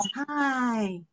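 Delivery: long falling sigh on HI. Acted. When you're down, a drawn-out sigh. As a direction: sigh out HI, letting it sink. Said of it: "when you're down".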